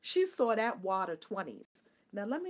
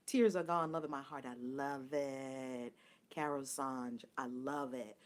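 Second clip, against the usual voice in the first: softer, lower, savoring; tender; 7 semitones lower, 6 dB quieter, slower than usual. Real, not acted. Host responding to listeners' love, soft and tender. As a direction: sink into a quiet, heartfelt appreciation.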